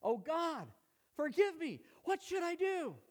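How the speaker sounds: high desperate plea to God; crying out. Acted. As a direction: cry out, begging forgiveness. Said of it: "So he cried out".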